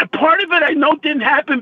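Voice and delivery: ordinary talk, nothing marked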